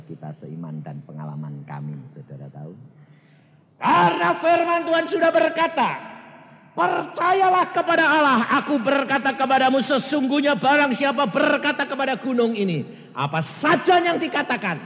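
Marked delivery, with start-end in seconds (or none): 3.8-14.8 loud, high scripture proclamation; preaching at full force. Acted. Preacher quoting Jesus to the congregation, loud. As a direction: proclaim the word to the hall.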